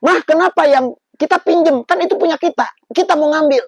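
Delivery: put-on high, loud indignant kid; protesting child. Boy protesting to his mother, high and loud. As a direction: whine it like a puzzled little boy. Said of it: "Saya kan yang ngomong".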